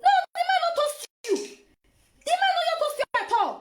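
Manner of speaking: rapid high-pitched rebuke; shrill disbelieving retort; high fast scolding. Real, not acted. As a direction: fire it back high and fast. Scolding the woman in the clip.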